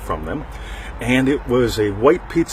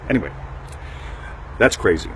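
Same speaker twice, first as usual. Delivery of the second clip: ordinary talk, nothing marked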